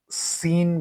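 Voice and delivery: "সিন" with a light s and long ee; wrong thin seen. Shown as a demonstration. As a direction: show the mistaken thin s in "সিন". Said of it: "বলা যাবে না". ("সিন"-এ সাদের বদলে ভুল পাতলা স)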